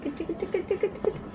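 soft high tickle coo; hushed tickling. Real, not acted. Mother tickling her baby in a soft, high coo. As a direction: breathe it softly while tickling.

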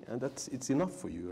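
ordinary delivery

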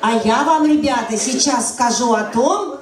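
ordinary delivery

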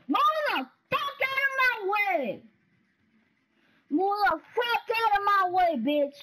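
high, loud furious yell; real rage shout; angry shout. Real, not acted. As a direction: scream at the defender. Shouting at game defender.

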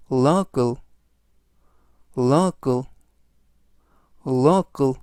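local repeated clearly, falling; model pronunciation. Shown as a demonstration. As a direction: say local slowly with a fall for learners. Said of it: "이라고 발음하시면 되겠고요".

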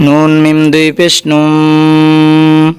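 নুম drawn out with ghunna; slow chanted spelling; slow spelled recitation. Shown as a demonstration. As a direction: spell it slowly and hold the nasal. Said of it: "ইকলাবের কায়দা".

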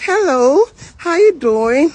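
high, loud sing-out hello; mock cheery greeting. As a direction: imitate a breezy passer-by greeting. Imitates cheerful passing client's greeting.

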